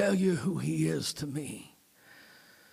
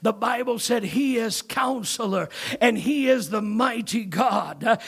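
loud, much higher building sermon climax; preaching at full force; 7 semitones higher, 6 dB louder than usual. Real, not acted.

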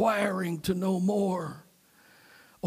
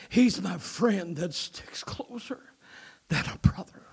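whispered adoration. Real, not acted. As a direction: whisper it like a secret of love. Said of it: "I say it with humble adoration".

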